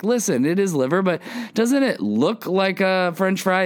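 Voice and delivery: high imitated mom, pleading her case; coaxing mom. Mom admitting the liver to her kid, high and coaxing. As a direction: pitch up as a persuading mom.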